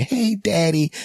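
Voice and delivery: put-on high sweet little girl voice; little daughter. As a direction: squeak it like a small daughter.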